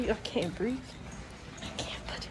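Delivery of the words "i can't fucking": whispered, out of air; breathless whisper. Real, not acted. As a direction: gasp it under a mask. Can't breathe in double mask.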